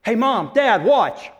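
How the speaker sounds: put-on eager little kid voice; three-year-old showing off. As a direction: call out like a small child wanting to be watched. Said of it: "three-year-old that goes".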